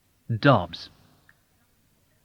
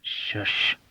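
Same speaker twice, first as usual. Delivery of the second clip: soft breathy hush; whispered shush; 5 dB quieter than usual. A narrator's reading. Soldier hushing his friend as he enters. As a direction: hush him with a finger to the lips. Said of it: "put a finger to his mouth".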